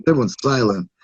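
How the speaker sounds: ordinary talk, nothing marked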